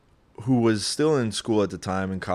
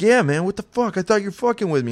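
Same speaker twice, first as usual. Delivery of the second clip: much higher, strained freaked-out voice; a scared buddy squeaking out an accusation; panicked friend; 11 semitones higher, 5 dB louder than usual. Retold or imitated. Friend on the couch accusing him, high and panicked. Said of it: "He's like".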